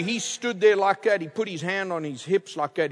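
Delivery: normal talking voice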